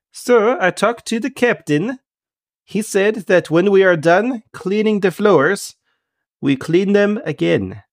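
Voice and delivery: high, slow character voice; Kent, deferential helper. Acted. Kent reports the captain's orders to Half Pint, high and slow. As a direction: report back slowly in a high-pitched voice.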